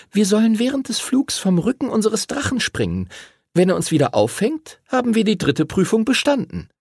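put-on high-pitched young rider explaining; boy explaining. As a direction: explain the big test in a high voice. Voices explanation of the jump.

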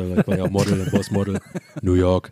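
deep, low rapper impression; low, lazy Rostock rapper voice; [name]. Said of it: "dein [name]".

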